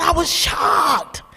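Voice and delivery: shocked, slow. Real, not acted. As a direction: stretch it out in wonder.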